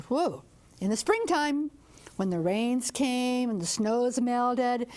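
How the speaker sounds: ordinary delivery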